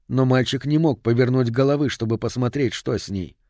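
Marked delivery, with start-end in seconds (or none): none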